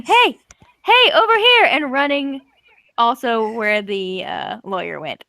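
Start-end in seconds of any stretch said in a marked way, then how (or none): 0.0-1.6 high, louder acted call-out; yelling for attention. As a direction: yell to draw it away. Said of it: "starts yelling".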